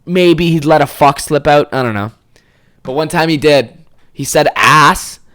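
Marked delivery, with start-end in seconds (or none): none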